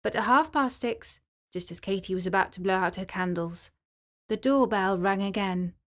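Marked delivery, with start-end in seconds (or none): none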